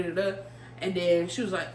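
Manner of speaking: ordinary talk, nothing marked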